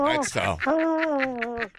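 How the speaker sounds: high, slow, strange alien sounds. Acted. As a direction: stretch it out like a space creature. Host performs alien orgasm.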